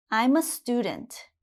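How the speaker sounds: "I'm a student" stated, pitch dropping at the end; falling statement intonation. Shown as a demonstration. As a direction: close "student" downward like a full stop. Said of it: "When I say it in a sentence".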